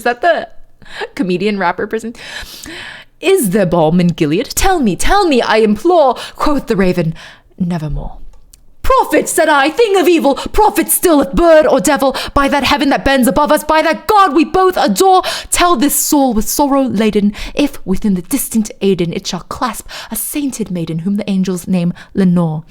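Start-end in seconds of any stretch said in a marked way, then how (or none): 3.2-8.2 pleading recital in posh British, dropping low on the refrain; fake posh British accent. Narrator imploring the raven, fake posh British accent. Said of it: "my fake ass posh British accent". 8.8-22.6 fake posh British accent. Narrator challenging the prophet bird, fake posh British accent. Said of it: "my fake ass posh British accent".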